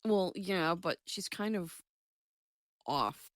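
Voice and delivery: ordinary delivery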